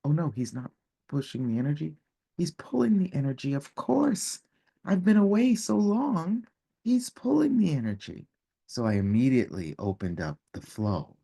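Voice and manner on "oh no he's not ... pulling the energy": rising higher and higher as it dawns; of course, the aha moment; sudden realization. Retold or imitated. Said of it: "I was like".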